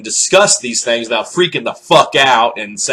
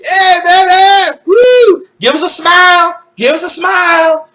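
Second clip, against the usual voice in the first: loud, high construction worker catcall; hammed-up high catcall; 5 dB louder than usual. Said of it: "so I'd be like".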